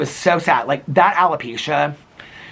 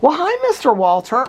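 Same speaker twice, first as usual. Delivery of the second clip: much higher sing-song hello to the dog; 12 semitones higher, 4 dB louder than usual. Real, not acted. Greeting her dog on camera.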